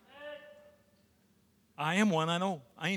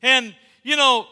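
ordinary delivery

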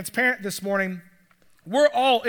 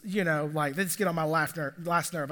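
low, quiet grumble of irritation; privately fed up, low and soft; judgmental grumble; 5 semitones lower, 7 dB quieter than usual. Acted. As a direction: grumble it under your breath, judging the neighbor. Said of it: "this judgmental attitude".

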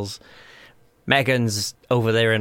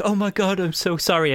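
apologetic dad; 9 semitones higher than usual. Retold or imitated. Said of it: "And then he's like".

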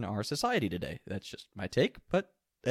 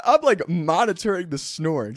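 normal talking voice

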